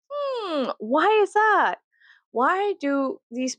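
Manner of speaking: high, sarcastic wondering hmm; mock puzzled. To the viewers about the ads, mock puzzled and high. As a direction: play dumb, all innocent and high. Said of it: "mock puzzled musing".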